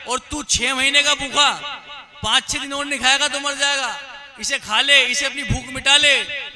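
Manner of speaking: very loud, high-pitched harangue; goading crow. Acted. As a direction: shout the lion into attacking, line after line. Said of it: "कौवे ने शेर को बोला".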